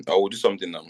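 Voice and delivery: ordinary delivery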